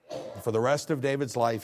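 ordinary delivery

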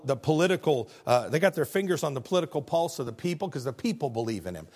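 usual speaking voice

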